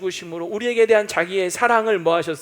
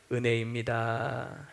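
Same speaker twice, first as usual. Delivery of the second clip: slow recitation; 7 semitones lower, 8 dB quieter, much slower than usual. Shown as a demonstration. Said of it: "시작".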